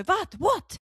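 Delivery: high, sharp exasperated question; hammed-up fed-up parent interrupting. Acted mum finally snapping at kids.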